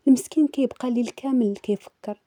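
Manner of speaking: plain reading voice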